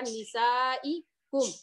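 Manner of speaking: نسائکم stretched slowly, syllable by syllable; teacher drawing out the word so students can follow; slow Quranic recitation. Shown as a demonstration. Slow careful recitation for the class. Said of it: "من حرف جر".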